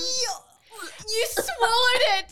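horrified shout. Real, not acted. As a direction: cry it out in horror. Horrified reaction to vomit story.

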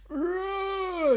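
long high drawn-out stag cry; slow-motion roar. Retold or imitated. Imitating the Stag in slow motion, drawn-out cry. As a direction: stretch out one high held bellow. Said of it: "in slow motion".

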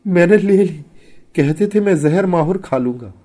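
high, loud, fearful wail; trembling confession. A narrator's reading. Trembling wife explaining why she took the gift, fearful. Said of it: "Muliya कांपती हुई बोली".

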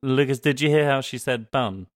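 ordinary talk

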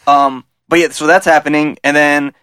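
ordinary talk

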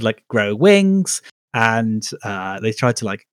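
usual speaking voice

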